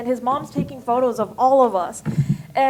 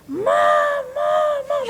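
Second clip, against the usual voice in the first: high-pitched mock scream of an excited boy; imitated child screaming; 4 dB louder, much slower than usual. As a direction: imitate a kid yelling for his mother. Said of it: "he just started screaming".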